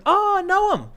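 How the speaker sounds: high, loud mock exclamation; excited recognition. Immigration officer hearing who the interviewee is, excited recognition. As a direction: imitate an official suddenly lighting up at a name.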